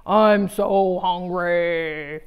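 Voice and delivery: slow, drawn-out hungry moan; hammed-up hungry groan; hungry whine.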